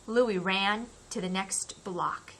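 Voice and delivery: ordinary delivery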